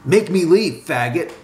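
high-pitched angry challenge; furious dare. A narrator's reading. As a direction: dare him with some fury, easing on the last word. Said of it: "said his friend with fury in his eyes".

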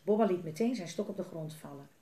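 ordinary delivery, nothing marked

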